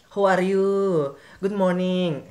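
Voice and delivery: loud, high English in a Thai accent; hammed-up Thai-accented English greeting. Shown as a demonstration. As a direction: call it out with a sing-song Thai lilt. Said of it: "menggunakan aksen atau logat dari logat Thailand".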